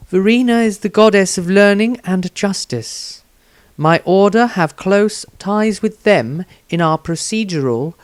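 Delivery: a dutiful woman sharing what she knows; Rysandria's voice. A narrator's reading. Rysandria explains her order.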